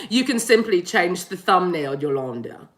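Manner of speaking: measured spirit-guide voice, dropping low on the name; Merrick. Acted. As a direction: play a patient guide, sinking low at the end. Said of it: "Merrick's like".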